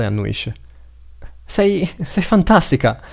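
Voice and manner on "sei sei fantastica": put-on high, slow-stretched childlike awe; excited child's exclamation. A narrator's reading. Boy to his rescuer, exclaiming in excitement. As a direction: exclaim it high, savouring the praise. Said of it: "esclamò l'altro bambino".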